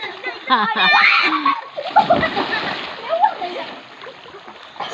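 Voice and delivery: high shouting laugh; loud delighted laugh. Real, not acted. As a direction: burst out laughing loudly. Disco light delight.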